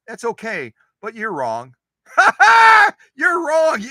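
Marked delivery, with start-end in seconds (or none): 2.1-2.9 high, loud burst of laughter; real shriek of a laugh. Real, not acted. Teasing viewers who disagree. 3.1-3.8 much higher, louder teasing call; laughing mock-scold, shouted; playful shout. Real, not acted. Teasing viewers who disagree.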